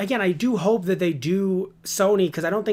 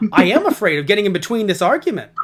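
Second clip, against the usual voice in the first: loud mock-frightened voice; hammed-up panic, stumbling at the start; scared; 3 semitones higher, 7 dB louder than usual. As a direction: play a frightened ghost, louder. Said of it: "he just looked so scared".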